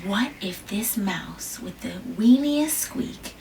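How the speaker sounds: plain reading voice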